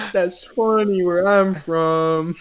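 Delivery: slow, high put-on voice; hammed-up slow imitation; imitating someone. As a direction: stretch it out as a mock quote. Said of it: "He went".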